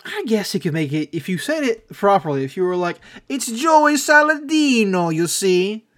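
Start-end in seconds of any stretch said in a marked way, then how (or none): 3.3-5.9 slow, higher mock Italian-American accent; playful Italian-American accent on the name. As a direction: drawl the name out like a Long Island Italian-American. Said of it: "a very good rendition of a Italian-American accent".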